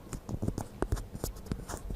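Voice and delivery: shaky laugh of relief; breathless laugh. Real, not acted. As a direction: laugh off the fright. After the scare.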